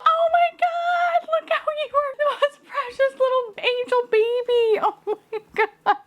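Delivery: very high, stretched squeal of adoration; cooing squeal. Real, not acted. Gushing over a precious little angel toy, squealing.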